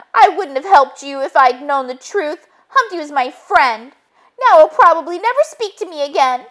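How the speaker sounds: high-pitched, upset character voice; indignant Muffy. Acted. Muffy glaring at Wolf over losing friend Humpty, high indignant voice. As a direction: voice a wronged friend, pitched well up.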